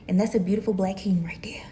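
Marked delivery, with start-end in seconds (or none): 1.2-1.6 hushed whisper of pride about her man. Real, not acted. To viewers about her partner, a fond whisper. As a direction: breathe it out as a whispered secret.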